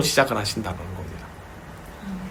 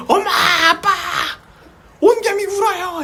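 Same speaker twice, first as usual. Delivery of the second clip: imitating child's loud call. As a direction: play an alarmed child calling parents. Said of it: "그 소리가 얼마나 크냐면은".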